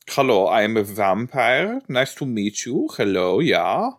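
hammed-up German vampire accent; super German accent. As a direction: greet them in a mock German accent. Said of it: "Like a super German".

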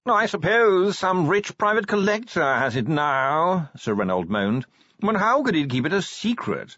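a preoccupied chicken of a posh gentleman, dragging each word; posh yawning curator. Acted. Curator voiced in story.

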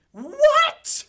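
a woman's outraged shriek; scream. Acted. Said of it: "Lola's voice scream out".

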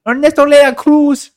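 very high, loud, rolled Spanish announcer; dramatic Spanish name. Pretend. Friend announcing a movie villain's name in a loud Spanish accent.

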